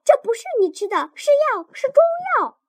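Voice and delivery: 急急地高声叫嚷，越说越尖; 抢瓶子时急得提高嗓门大喊; 叫着说. Acted. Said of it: "口里叫着". (急得又快又高地喊)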